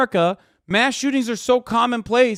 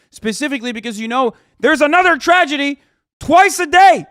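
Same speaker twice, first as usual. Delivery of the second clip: builds from louder to a high, loud shout on the last words; rising angry yell; 6 semitones higher, 6 dB louder than usual. Real, not acted. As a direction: climb into a furious yell.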